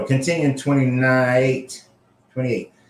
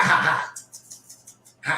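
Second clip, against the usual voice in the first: usual speaking voice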